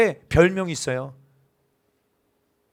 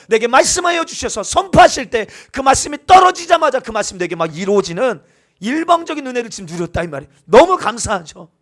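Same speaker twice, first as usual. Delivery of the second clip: loud, higher impassioned preaching; fervent preaching; 8 semitones higher, 6 dB louder than usual. Acted. Pastor exhorting the congregation about grace, loud and higher. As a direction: preach it with rising fervor.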